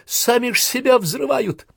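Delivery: very high-pitched disbelief; amazed. A narrator's reading. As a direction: exclaim it in astonishment. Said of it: "с изумлением заметил Полянкин".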